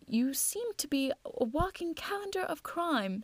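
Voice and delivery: gently ribbing, in a lifted voice; lightly amused Stamford. Acted. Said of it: "said Stamford with a laugh".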